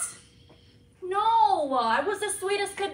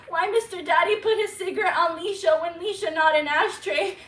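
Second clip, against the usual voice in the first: put-on a scared small child giggling through tears; little girl alter. Switches into childlike alter Alicia.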